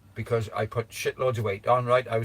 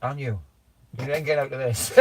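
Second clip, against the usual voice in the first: ordinary talk, nothing marked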